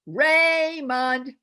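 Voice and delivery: loud, high yell with the mouth wide open; mom calling child. Shown as a demonstration. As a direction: call your kid in from outside. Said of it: "yell it like a mom calling her child".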